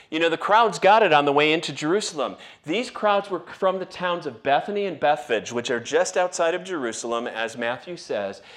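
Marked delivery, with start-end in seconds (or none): none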